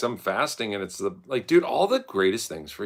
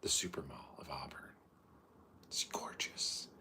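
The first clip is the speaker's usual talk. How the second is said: whispered sarcasm; 5 semitones lower, 15 dB quieter than usual. Real, not acted. Mock praise of a mall.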